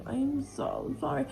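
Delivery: I am so sorry, lifted high and eager. Retold or imitated. Retelling her apology to him.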